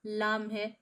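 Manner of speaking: normal talking voice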